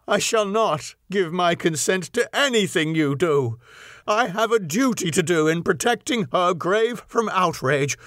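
high, impassioned protest; storm of passion. A narrator's reading. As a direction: pour out outraged grief at him. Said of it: "cried Arthur in a storm of passion".

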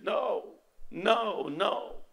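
soft, mildly distressed imitation of crying; retold tearful refusal. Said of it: "crying and shaking, said".

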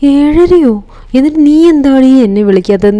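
high, loud alarmed outburst at the wife; startled angry scolding. Retold or imitated. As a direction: blurt it out, shocked and annoyed he overslept. Husband wakes late, scolds wife.

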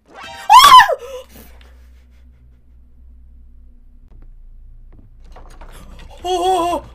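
loud frightened shout; startled scream. Real, not acted. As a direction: yell out in sudden fright. Jump scare shout.

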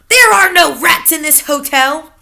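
high, loud manager protest; indignant denial. Acted. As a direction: insist hotly as the manager. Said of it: "cried Mister Stringer".

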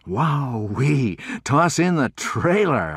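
high, loud thrilled exclamation; gleeful shout of delight; excited cry. Acted. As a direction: cry it out, amazed at the deal. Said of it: "I cried".

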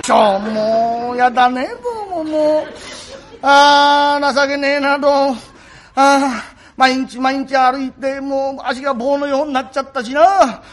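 slow, drawn-out weary moaning; 疲れ果てたぼやき. Acted. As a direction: groan along, worn out from endless walking. 追い出され一人で嘆く. (疲れ果てて間延びした嘆き)

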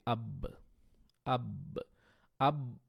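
আব with the ব bounced open after closing; qalqala demo. Shown as a demonstration. Teacher shows qalqala on বা. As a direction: close on ব, then release it. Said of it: "যেমন যদি আমি বা দিয়ে করি".

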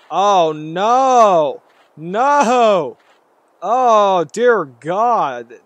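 loud, high cry of dismay; alarmed outcry. Real, not acted. Gamer reacting to a horror game scare, loud alarmed outcry. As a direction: wail in alarm at the sight.

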